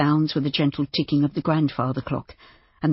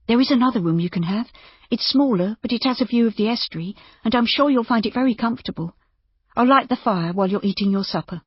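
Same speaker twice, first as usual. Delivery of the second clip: higher woman's character voice, persuading; kindly woman innkeeper; 7 semitones higher than usual. A narrator's reading. Innkeeper offering the doctor a room, kindly. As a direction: coax him to stay, pitched up.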